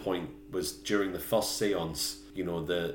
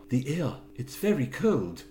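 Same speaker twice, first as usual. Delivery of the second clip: higher put-on film-character voice; Roger; 5 semitones higher than usual. Co-host quoting Roger to Helen, put-on higher voice. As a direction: pitch it up, acting out the line. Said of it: "Roger said to her".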